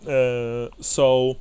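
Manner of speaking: ordinary talk, nothing marked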